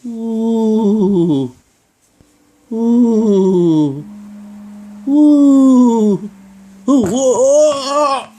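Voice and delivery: high, loud ghostly oooh; ghost moan. Acted. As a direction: moan like a spooky Halloween ghost. Ghost moaning before eaten.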